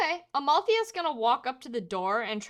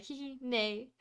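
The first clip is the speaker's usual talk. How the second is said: soft giggly horse whinny; playful whinny; 9 dB quieter, slower than usual. Acted. Amalthea prances for the men, soft giggly neigh.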